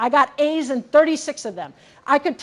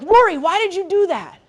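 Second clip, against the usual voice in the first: raised-pitch mock scolding, no shout; mildly cross, higher-pitched telling-off; mildly mad parent; 5 semitones higher than usual. Mother retelling how she scolds her seven-year-old daughter, mildly mad. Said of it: "I'll sometimes get mad at her".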